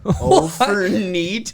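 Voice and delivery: high, slow, hammed-up North Dakota Oh, for neat; North Dakota accent. Repeating the phrase for friends, hammed-up North Dakota accent. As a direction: stretch it out like a cheerful Dakota farmer. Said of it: "North Dakota love".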